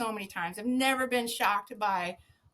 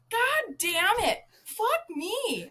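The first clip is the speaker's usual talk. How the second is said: high, slow mock wail of curses; retold exasperated cursing; 11 semitones higher, much slower than usual. Zookeeper hit again at the door, high exasperated cursing. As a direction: curse in comic exasperation at each jolt. Acted cursing at repeated shocks.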